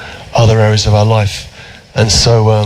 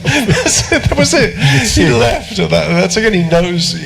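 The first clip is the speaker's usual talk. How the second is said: laughing; 8 semitones higher than usual. Real, not acted. Laughing at Cantona story.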